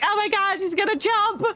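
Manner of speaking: panicked onlooker. Retold or imitated. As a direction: squeal it like a frightened bystander. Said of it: "everyone's freaking out like".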